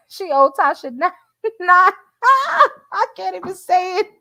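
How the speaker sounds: high, loud laughter breaking the words; laughing too hard to finish; real laughing fit. Real, not acted. Laughing while reading comment.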